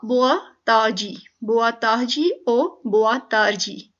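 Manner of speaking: "बोआ ताजी" said slowly, with the r dropped, then "बोआ तारजी" with the r; slow pronunciation demo. Shown as a demonstration. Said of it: "बोआ ताहजी बोलेंगे".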